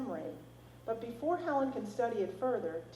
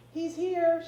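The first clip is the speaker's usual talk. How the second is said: louder, higher call into the woods; calling back; 9 semitones higher, 8 dB louder than usual. A narrator's reading. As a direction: call back across the distance. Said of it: "she calls back".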